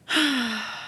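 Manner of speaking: breathy defeated sigh; whispered sigh. Real, not acted. Forgets her point.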